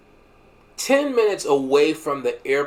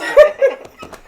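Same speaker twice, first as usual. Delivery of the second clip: high squealing laugh; giddy high laugh; 10 dB louder than usual. Retold or imitated. Laughing at the beans memory.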